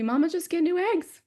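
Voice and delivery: put-on high, light child's voice; quoting her small daughter, high and light; little daughter. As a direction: pitch up into a small child asking.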